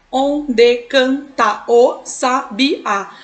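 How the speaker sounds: slow "Onde canta o sabiá", one syllable at a time; measured syllable-by-syllable count of "Onde canta o sabiá". Shown as a demonstration. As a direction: chop it into on, de, can, ta o, sa, bi, á. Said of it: "a gente vai contar".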